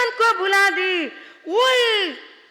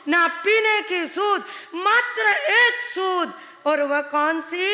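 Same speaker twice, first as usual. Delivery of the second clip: normal talking voice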